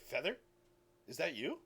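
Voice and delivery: tentative, puzzled question with a rising end; a touch startled, unsure who is speaking; mildly jolted. Acted. Friend answers a sudden message in his head, mildly jolted. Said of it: "a little bit jolted by the sudden message".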